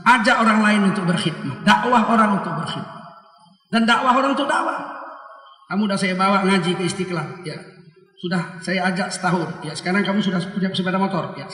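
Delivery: ordinary talk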